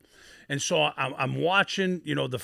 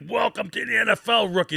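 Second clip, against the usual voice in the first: higher mocking jeer; a hint of taunting veterans; 8 semitones higher, 3 dB louder than usual. Veterans jeering at the beaten-up rookie, mocking. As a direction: rub it in with a sneer.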